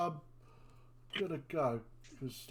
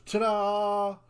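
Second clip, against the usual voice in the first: excited ta-da; 8 semitones higher, 5 dB louder than usual. Acted. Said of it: "And Trellador goes".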